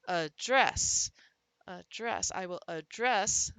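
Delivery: "address" with a schwa first, then a punched, stretched second syllable; stress on second syllable. Shown as a demonstration. Teacher demonstrates schwa to students, second syllable of "address" stressed. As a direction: weaken the start of "address" and land hard on its end. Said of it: "can become the schwa sound".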